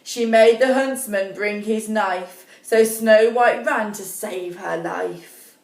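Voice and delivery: usual speaking voice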